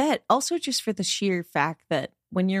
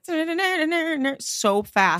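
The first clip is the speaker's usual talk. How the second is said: drawn out; 9 semitones higher than usual. Acted. Describing scooter riders' speed.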